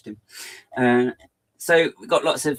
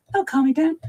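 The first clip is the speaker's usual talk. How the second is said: That'll calm it down said much higher; high squeaky repeat; 5 dB louder than usual. Shown as a demonstration. Streamer mocks himself for being hyper, squeaky. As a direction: squeak it back up ironically. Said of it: "talking very fast".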